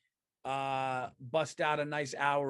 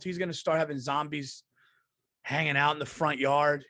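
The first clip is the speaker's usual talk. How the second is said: normal talking voice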